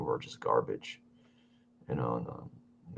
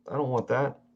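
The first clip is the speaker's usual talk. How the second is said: yawning; 5 semitones higher than usual. Real, not acted. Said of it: "yawning and tearing up".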